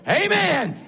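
shouted, high exultant preaching; preaching at full force. Real, not acted. Preacher rallying his congregation, shouted high exultation. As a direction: lift the congregation to shout praise.

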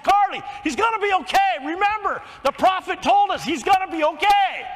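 high, fast, loud burst of joy on the phone; excited reassurance. Retold or imitated. Father calling his daughter after the birth, excited and loud. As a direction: blurt the good news, nearly shouting.

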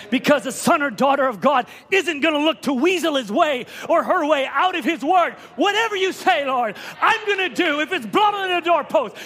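high, impassioned vow from the pulpit; fervent preaching. Real, not acted. Preacher vowing obedience to the Lord, fervent preaching. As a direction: build to a ringing declaration.

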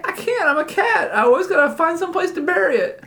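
chatty cartoon cat voice, high; talking cat.

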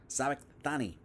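"Sabachthani" said as sah-bak-THAH-nee; tentative pronunciation. Shown as a demonstration. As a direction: sound out "Sabachthani" with a clear th before the -ani. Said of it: "I think is how you say that".

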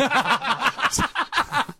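long high burst of laughter; laughing fit. Real, not acted. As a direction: crack up helplessly. Speaker cracks up mid-rant.

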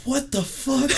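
way up high, incredulous; shocked disbelief. Retold or imitated. Recruits reacting to the Compton announcement, high incredulous outburst. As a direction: blurt it in total disbelief.

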